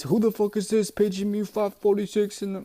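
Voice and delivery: high-pitched quoted rap line in a put-on voice. Fan quotes a famous rap lyric, imitating the rapper. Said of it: "like that [name]".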